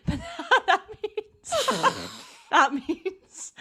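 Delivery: laughing. Real, not acted. Laughing at her own accent.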